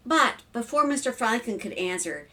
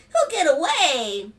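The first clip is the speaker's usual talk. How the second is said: high, slow, louder plea; pleading little boy; 7 semitones higher, 6 dB louder, slower than usual. A narrator's reading. Son fears losing frog, pleading child. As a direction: protest like a worried kid.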